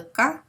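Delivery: "ka" with a hard k sound. Shown as a demonstration. The teacher shows students the hard c, "ka". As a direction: say "ka" as a crisp k. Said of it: "We have the hard C".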